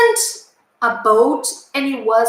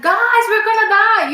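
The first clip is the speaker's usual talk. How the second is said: higher, half-hearted imagined yell; mock scream; 9 semitones higher, 3 dB louder than usual. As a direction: pretend to shout a warning at the crowd. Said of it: "I couldn't start screaming".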